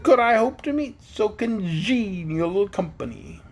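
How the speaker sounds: high, slow, pompous bug; Wogglebug voice. A narrator's reading. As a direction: savour every grand word.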